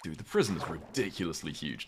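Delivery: ordinary talk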